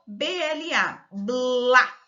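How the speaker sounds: "B L A" spelt slowly, then "blá" run together; letters one by one, then a loud, blended "blá"; blending the syllable. Shown as a demonstration. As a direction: spell out "B L A", then push out a joined "blá". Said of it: "juntando a sílaba".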